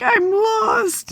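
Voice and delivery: high, tearful wail; crying. Retold or imitated. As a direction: sob out the words, lost and helpless. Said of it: "I'm crying".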